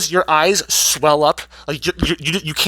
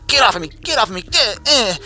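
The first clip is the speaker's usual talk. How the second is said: higher, squirming mock protest; mock struggling protest; 6 semitones higher than usual. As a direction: wriggle free in a hammed-up voice. Amber struggling in bear hug.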